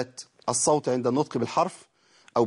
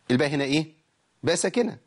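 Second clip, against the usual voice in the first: normal talking voice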